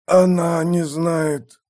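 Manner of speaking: high, slow, chilling voice; icy, eerie. A narrator's reading. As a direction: say it slowly, chillingly. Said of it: "Голос был морозен и жуток".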